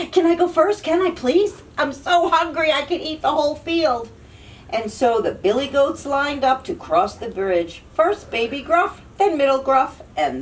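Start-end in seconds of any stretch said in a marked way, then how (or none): 0.1-4.1 eager. Acted. Little goat begging eagerly.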